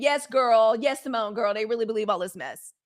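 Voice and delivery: ordinary talk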